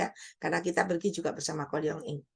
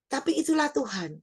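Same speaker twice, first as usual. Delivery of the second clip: usual speaking voice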